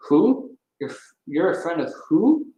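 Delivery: high, loud "Who?" flung down the phone; a tigress woken at night, half yelling; near yelling. Acted. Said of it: "She was damn near yelling at me".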